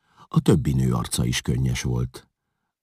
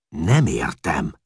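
annoyed; 5 dB louder, much slower than usual. A narrator's reading. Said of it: "bosszankodott Csát".

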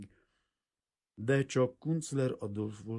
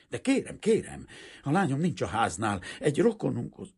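frightened pleading; 8 semitones higher, 8 dB louder than usual. A narrator's reading.

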